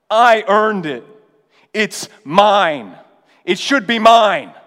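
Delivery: a bitter son claiming what he earned; resentful outburst. Retold or imitated. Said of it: "He sounds like a slave".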